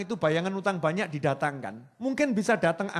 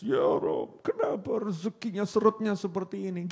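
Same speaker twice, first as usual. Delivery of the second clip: mock crying prayer; 2 semitones higher than usual.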